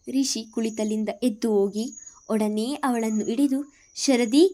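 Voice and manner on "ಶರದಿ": higher, louder alarmed question; startled worry for her; anxious. Acted. Brother rushing to hold his sister, anxious question. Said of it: "ಎಂದನು ಆತಂಕದಲ್ಲಿ".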